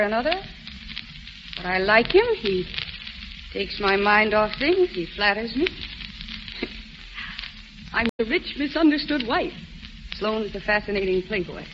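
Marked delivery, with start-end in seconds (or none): 5.7-6.8 quiet unvoiced chuckle; a little airless laugh; soft breathy laugh. Amused at her own flirtation.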